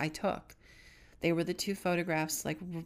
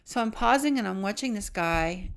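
usual speaking voice

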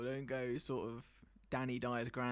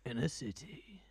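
half-whispered movie trailer voiceover; close-to-the-mic film narrator impression; eighties movie man; 4 semitones lower than usual. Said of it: "like, the eighties movie man".

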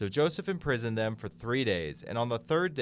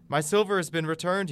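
higher, louder cry of discovery; startled exclamation; 7 semitones higher, 5 dB louder than usual. A narrator's reading. Brother finding his silver in the sack, startled. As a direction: exclaim it in astonishment.

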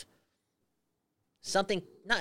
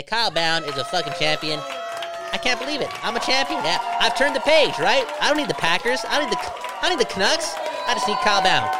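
hyped self-celebration; 5 semitones higher, 4 dB louder than usual. Pretend. Hyping himself as champion.

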